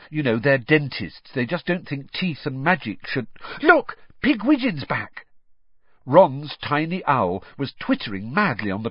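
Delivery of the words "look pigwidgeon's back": excited. A narrator's reading. Spotting the owl returning.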